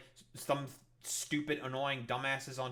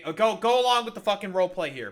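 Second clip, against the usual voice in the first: louder, fast, exasperated push; frustrated loud; 4 semitones higher, 9 dB louder, faster than usual. Real, not acted. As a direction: hurry him along, exasperated. Frustrated at co-host.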